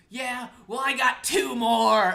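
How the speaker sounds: high, loud, drawn-out mimicked yell; shouted comeback. Mom firing back at her guilt-tripping son, shouted. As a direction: imitate a mom hurling back a savage retort. Mom's furious comeback, retold.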